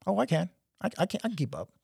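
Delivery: high, mock-confident I can; cocky boast. Young soldier to older guys, high mock-cocky boast. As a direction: puff up and insist you can keep up. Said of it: "And I was like".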